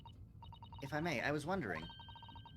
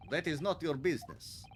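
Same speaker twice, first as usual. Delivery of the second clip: curt Russian refusal; 3 semitones higher than usual. Acted. Sailor refuses.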